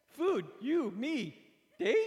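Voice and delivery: high, loud broken caveman grunts; hammed-up caveman date request; caveman words. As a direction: blurt single words like a caveman. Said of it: "it came out like".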